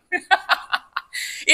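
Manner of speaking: light airless giggle; short unvoiced laugh; high breathy laugh. Real, not acted. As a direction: giggle at your own point. Laughing at own joke.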